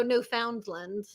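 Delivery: Newfoundland with a full "found" in the middle; spelled-out pronunciation. Real, not acted. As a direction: say Newfoundland as new-FOUND-lund, drawn out. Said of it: "I don't think you say Newfoundland".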